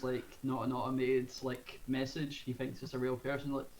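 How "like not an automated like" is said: normal talking voice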